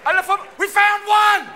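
high, loud mock alarm; alarmed shout. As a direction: holler out the discovery to the team. Acted security alarm on spotting suspect.

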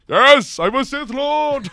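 loud gleeful proclamation; gleeful villain shout. Pretend. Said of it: "where he's just like".

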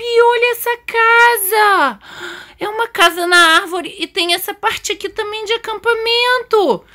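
put-on very high, squealing child voice with stretched words; hammed-up childish delight; thrilled little daughter. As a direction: gush like an awestruck kid, high and drawn out. Voices the daughter thrilled at tree house.

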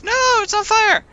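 real alarm as the flame catches. Real, not acted. Player seeing the letter catch fire, alarmed high cry. Letter burns too much.

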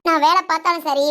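ordinary delivery

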